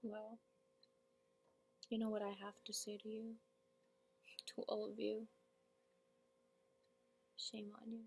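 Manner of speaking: very soft, restrained reproach with long pauses; monologue character, hurt. Acted. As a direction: accuse them under your breath.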